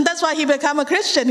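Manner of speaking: normal talking voice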